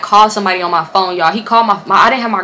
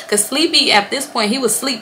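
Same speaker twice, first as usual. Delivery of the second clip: usual speaking voice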